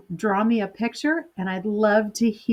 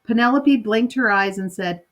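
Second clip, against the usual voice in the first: ordinary delivery, nothing marked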